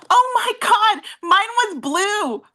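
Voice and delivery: high, delighted gasp of surprise; excited surprise. Real, not acted. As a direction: squeal with recognition. Sees the same old book.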